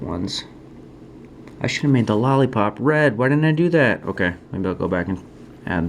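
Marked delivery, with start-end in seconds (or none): 1.6-4.0 higher and louder, scolding himself; rueful outburst. Real, not acted. Artist scolding himself over the lollipop colour, rueful outburst. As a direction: kick yourself out loud over a missed detail.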